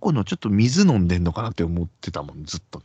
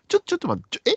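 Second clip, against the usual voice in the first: much higher, stammering panic; flustered; faster than usual. Retold or imitated. Narrator retelling his panic at the table, high and stammering. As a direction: caught off guard, scrambling. Panicking about paying for drinks.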